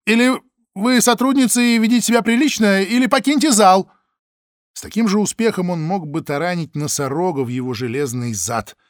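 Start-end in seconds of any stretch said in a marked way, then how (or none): 0.1-3.9 loud, high official yelling; angry shout. Acted. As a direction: bark it at the witness like an officer. Чекист кричит на свидетельницу. (гневный окрик чиновника)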